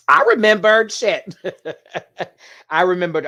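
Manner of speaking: loud, high and breaking into laughter; real gleeful shout; delighted outburst. Real, not acted. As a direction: exclaim it in triumph, then crack up. Delighted at remembering, bursts out laughing.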